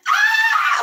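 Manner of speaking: very high, loud scream of fright; hammed-up little sister shriek; shrill scream. Little sister shrieking at the doll, scream. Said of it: "And she's like".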